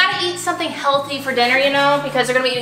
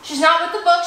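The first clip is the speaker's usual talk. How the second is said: ordinary talk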